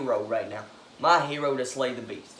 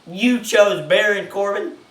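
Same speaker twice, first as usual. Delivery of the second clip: loud, slow, higher disbelief; incredulous outburst; 7 semitones higher, 10 dB louder, slower than usual. Retold or imitated. Ranting at Kurt Angle over his pick, loud incredulous outburst. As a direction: demand it like you can't believe the choice.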